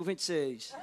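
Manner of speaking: low, soft retold reply; acted subdued bidder; meek counter-bid. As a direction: answer the bid in a small voice. Meek answering bid after rival's shout.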